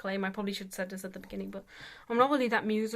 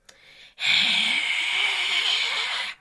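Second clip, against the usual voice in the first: loud rising growl, higher; full growl; 11 semitones higher, 9 dB louder than usual. Shown as a demonstration. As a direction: bring the whisper up into a full growl. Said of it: "and then bring it up".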